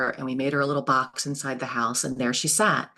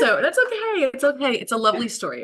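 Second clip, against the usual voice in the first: higher, fighting back real tears; choked up; 10 semitones higher, 4 dB louder than usual. Real, not acted. Reassuring the host while welling up over the hen story. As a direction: voice pitched up as emotion rises. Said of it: "now you're gonna make me cry".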